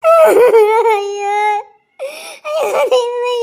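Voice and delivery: loud sobbing cry of despair; anguished wail. Acted. Daughter wails on learning who her father is, sobbing loudly. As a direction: let out a broken, crying wail.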